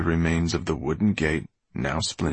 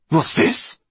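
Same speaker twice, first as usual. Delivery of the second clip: put-on high, loud old-villainess voice; Mortola's outraged cry; 10 semitones higher, 5 dB louder than usual. A narrator's reading. Mortola facing her son's ruined fortress, outraged cry. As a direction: cry out in shrill disbelief. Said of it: "Mortola's voice echoed from the dead walls".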